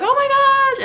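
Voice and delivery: very high, loud joyful cry; telling family on the phone she passed, screaming. Retold or imitated. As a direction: shriek it out through happy tears.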